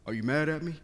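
a hint of a worried buddy checking in; insecure friend. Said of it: "He'd be like".

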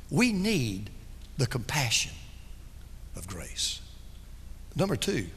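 hushed. Real, not acted. Summing up his point hushed.

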